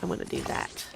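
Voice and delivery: soft unvoiced whisper; hushed self-talk. Real, not acted. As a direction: whisper it to yourself while sneaking. Sneaking in game, muttering to self.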